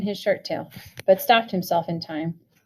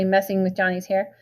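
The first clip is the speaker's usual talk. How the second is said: plain reading voice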